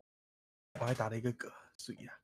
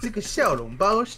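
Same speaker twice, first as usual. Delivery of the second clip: fake ABC accent; 6 semitones higher, 4 dB louder, slower than usual. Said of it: "那种假A B C".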